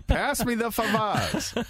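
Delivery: high, slow [name] mimic; imitating [name]. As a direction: drawl it out like [name]. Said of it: "I use that one in a promo".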